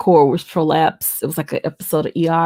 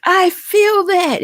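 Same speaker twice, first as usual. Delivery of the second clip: alarmed cry; 13 semitones higher, 4 dB louder, much slower than usual. Retold or imitated. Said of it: "I was like".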